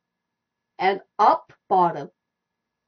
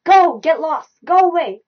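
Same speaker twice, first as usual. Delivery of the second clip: louder, higher mock-angry dismissal; mad voice; 6 semitones higher, 7 dB louder than usual. Shown as a demonstration. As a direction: send them off like you mean it. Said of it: "mad voice".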